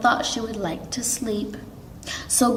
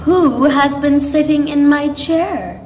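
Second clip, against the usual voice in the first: Mother Bear, higher and louder; medium-sized voice; 6 semitones higher, 6 dB louder than usual. A narrator's reading. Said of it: "she asked in a medium-sized voice".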